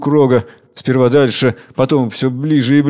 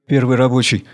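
plain reading voice

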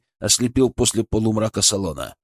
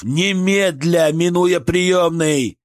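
urgent command; 6 semitones higher, much slower than usual. A narrator's reading. Surgeon continues the order.

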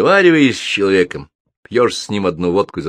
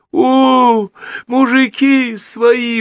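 loud, very high gushing cry; overjoyed; 5 dB louder, much slower than usual. A narrator's reading. Major recognising his own men, loud high gushing relief. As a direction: wail with touched delight. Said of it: "умилился майор".

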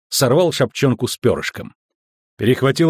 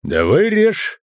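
higher-pitched, tight dare; said through teeth; 9 semitones higher, much slower than usual. A narrator's reading. Customs officer calling the guard's bluff, through teeth. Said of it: "процедил Ольсен".

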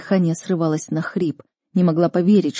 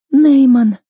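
high, loud, drawn cry of a name; tormented girl calling out high; anguished girl's call; 7 semitones higher, 10 dB louder than usual. A narrator's reading. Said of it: "такой измученный и безжизненный". (высокий громкий измученный девичий зов)

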